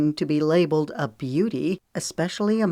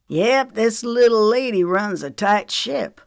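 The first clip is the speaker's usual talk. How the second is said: Jackson's line; 6 semitones higher, 4 dB louder than usual. A narrator's reading. Jackson praising Lemon.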